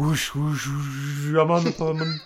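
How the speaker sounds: loud windy call from a chairlift; shouting over wind on the slopes; on a ski slope. Retold or imitated. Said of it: "trying to call in from the ski slopes".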